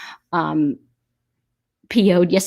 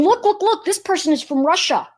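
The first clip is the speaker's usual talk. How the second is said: mock accuser; 9 semitones higher than usual. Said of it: "those people are going".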